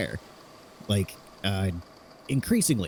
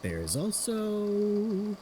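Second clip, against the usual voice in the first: drawn-out, much higher echo of the trailing words; teasing echo; 11 semitones higher, 5 dB quieter, much slower than usual. Acted. Friend teasingly echoing a hesitant speaker, drawn out and high. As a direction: stretch it slowly to nudge them on.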